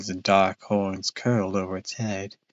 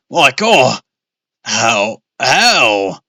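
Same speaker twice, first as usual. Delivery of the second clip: horrified cry; 2 semitones higher, 7 dB louder, much slower than usual. Acted. Father staring at where his car exploded, horrified cry. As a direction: wail over the lost car.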